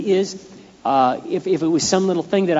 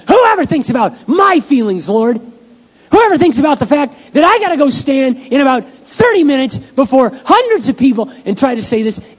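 pity party whine; 10 semitones higher, 6 dB louder than usual. Retold or imitated. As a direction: whine to God like a wounded child. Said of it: "having your little pity party".

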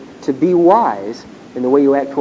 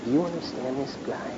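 much softer, a mock side remark; muttered aside; 3 semitones lower, 15 dB quieter than usual. Student muttering to Cliff during a boring lecture. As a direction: lean over and murmur to your classmate.

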